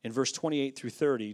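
usual speaking voice

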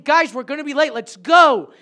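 loud urgent call to the team; loud call to get moving. Retold or imitated. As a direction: hurry them along, shouting. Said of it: "and I'm saying".

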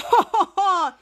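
staccato ho-ho laughing; hero's mock laugh. Hero rallying everyone, put-on ho-ho laugh. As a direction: put on a showy string of hos.

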